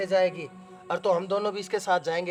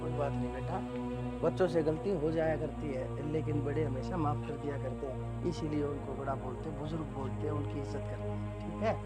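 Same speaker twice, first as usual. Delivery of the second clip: lower, softer fatherly reassurance; gentle forgiveness; 5 semitones lower, 8 dB quieter than usual. Acted. Father-in-law forgiving his son's wife, softly reassuring. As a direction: soothe her kindly.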